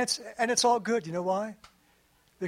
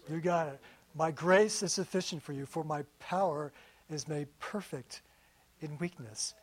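lower, softer, calm reassurance; God calmly answering the anxious speaker; reassuring voice of God; 5 semitones lower, 6 dB quieter than usual. Retold or imitated. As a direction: answer gently, like a steady father.

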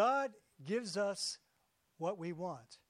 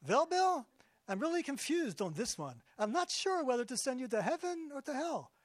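a hint of a Yiddish-accented God, higher; 4 semitones higher, 3 dB louder than usual.

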